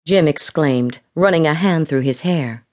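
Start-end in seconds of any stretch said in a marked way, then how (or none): none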